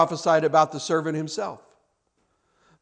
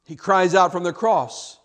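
normal talking voice

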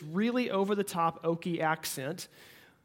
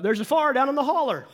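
loud, higher hillbilly twang, "far" meaning fire; grandpa's Ozark accent; 6 semitones higher, 6 dB louder than usual. Retold or imitated. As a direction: boom it out like an old Ozark grandpa. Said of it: "my grandpa ... say this sentence".

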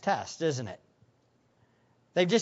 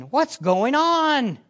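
higher, drawn-out panicked question; mock panic; 6 semitones higher, 4 dB louder, much slower than usual. Preacher voicing disciples' panicked thoughts, mock panic. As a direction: act out the bewildered disciples, stretched out. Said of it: "they probably were panicking".

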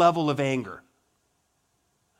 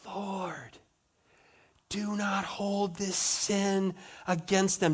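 soft dying plea; 7 dB quieter, slower than usual. Acted. Stephen's final cry, softly.

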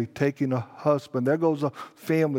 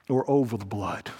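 OR OVER THE BLOOD dropped low and soft; falling alternative; 8 semitones lower, 5 dB quieter than usual. Real, not acted. Preacher warning congregation of other choice, low and grave. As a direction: let "or over the blood" sink low and quiet.

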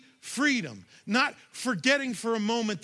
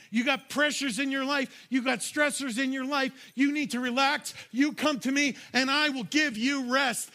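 raised, earnest pleading invitation as Jesus; voicing Jesus; 5 semitones higher than usual. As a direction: call the weary in, higher and fervent.

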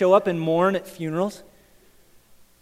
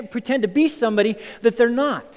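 ordinary delivery

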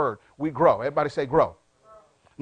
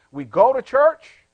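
loud, slow, raised on church; emphatic preaching; 6 semitones higher, 9 dB louder, slower than usual. Real, not acted. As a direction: proclaim it big and high, rising at the end. Preacher contrasts going with leaving.

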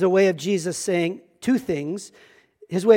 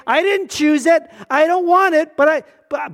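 higher, louder, mildly acted protest; protesting complaint; 6 semitones higher, 4 dB louder than usual. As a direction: push back against an unwanted burden. Voices someone resenting their burden.

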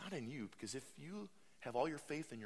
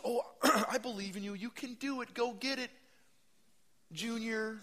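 high, loud, sing-song pep talk; high, chirpy fake encouragement; mock cheerleader; 11 semitones higher, 6 dB louder than usual. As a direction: parody a hollow cheerleading coach. Mocking shallow encouragement.

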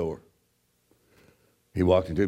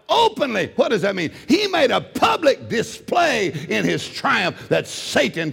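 fervent preacher building to a shout; impassioned pulpit proclamation, key words stretched; full-force preaching; 10 semitones higher, 4 dB louder than usual. Real, not acted. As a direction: preach it hard, higher and higher.

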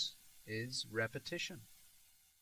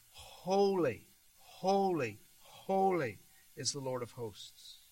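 first two holies lifted and louder; proclaimed holy; 3 dB louder, slower than usual. Shown as a demonstration. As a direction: announce it with awe. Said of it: "what do the seraphim mean when they say".

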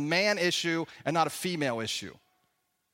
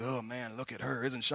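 low, much softer leering mutter; leering old coaches; 8 semitones lower, 10 dB quieter than usual. Retold or imitated. Fifty-year-old coaches leering at moms, low and hushed.

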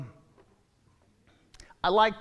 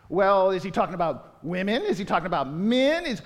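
ordinary delivery

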